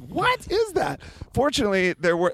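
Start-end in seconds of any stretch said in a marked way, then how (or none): 0.0-1.0 very high incredulous question; appalled, pitch leaping up; retold shocked disbelief. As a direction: squeal it in mock horror. Retold shock at wrong song.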